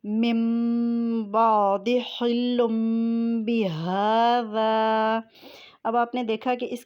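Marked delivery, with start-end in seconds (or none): 0.0-5.2 slow drawn-out recited example with nasal ghunna; tajweed recitation. Shown as a demonstration. Teacher demonstrating iqlab to students with slow recitation. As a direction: recite the example slowly, holding the sound. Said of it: "آئیے اقلاب کی مثال دیکھ لیتے ہیں".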